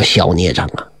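deep, low and soft rebuke; stern old Taoist. A narrator's reading. Priest rebuking Nezha for chasing his father. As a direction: scold like a grave old priest.

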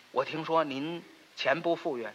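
normal talking voice

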